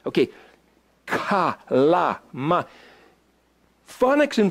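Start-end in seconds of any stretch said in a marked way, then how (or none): 1.1-2.6 KA, LA, MA as separate open syllables; letter sound demo. Shown as a demonstration. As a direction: sound out KA, LA, MA one at a time. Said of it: "This letter is pronounced".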